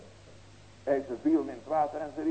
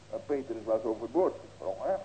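normal talking voice

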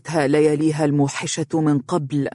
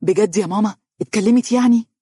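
higher, louder joyful question; happy exclaim; 5 semitones higher, 4 dB louder than usual. Acted. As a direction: burst out with happy disbelief. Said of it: "هتف يحيى بسعادة".

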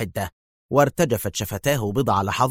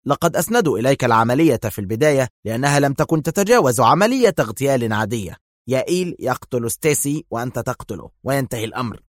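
ordinary delivery, nothing marked

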